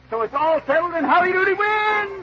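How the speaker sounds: high, laughing triumphant shout; laughing cheer. Real, not acted. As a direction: cheer the win through laughter. Host celebrates victory.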